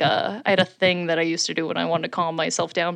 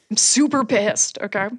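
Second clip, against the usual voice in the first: ordinary talk, nothing marked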